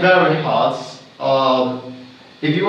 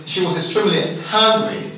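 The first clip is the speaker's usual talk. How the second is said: "hungry" set apart and emphasized; stress on hungry; faster than usual. Shown as a demonstration. Teacher demonstrating standard stress, weight on "hungry". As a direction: put the main beat on "hungry". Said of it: "I'm gonna stress this one".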